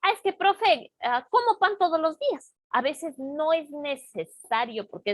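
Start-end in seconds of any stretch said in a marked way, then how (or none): 0.0-2.5 higher, louder casual student excuse. Retold or imitated. Teacher imitating a student in a higher, louder voice. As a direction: mimic a student talking back to the teacher. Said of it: "quitamos del sujeto y decimos".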